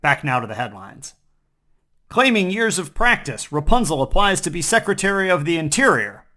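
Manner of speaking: normal talking voice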